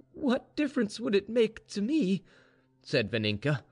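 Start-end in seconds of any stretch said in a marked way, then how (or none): 0.1-2.2 anguished protest. Acted. Grieving mistress protests to maid.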